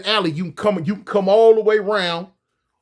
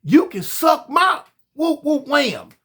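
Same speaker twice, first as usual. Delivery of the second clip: high mock woman's voice, drawn out; lightly voiced woman talking trash; imitating her insult, pitched up; quoting her; 5 semitones higher, slower than usual. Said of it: "a woman tell me".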